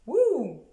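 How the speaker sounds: loud, high "wouh"; mock-triumphant whoop. Vlogger shows off her knitted sleeve, mock-triumphant whoop. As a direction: cheer your own tiny progress with a whoop.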